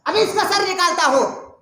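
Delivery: high, loud, fierce demand; burn with holy anger, ready to strike; furious zeal. Acted. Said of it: "جلال میں کہنے لگے".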